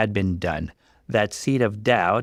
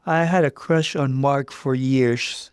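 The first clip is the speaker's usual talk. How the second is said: sheepish admission; 5 semitones higher than usual. Acted. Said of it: "she admitted".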